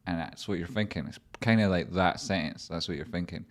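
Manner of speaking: ordinary delivery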